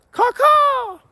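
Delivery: loud high cawing like a crow. Pretend. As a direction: caw like a blackbird. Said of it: "That's Blackbird for shut the fuck up".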